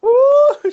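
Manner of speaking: high, loud imitated hoot; mock high "ooh". Asking did she go like this, squealed hoot. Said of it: "did she go".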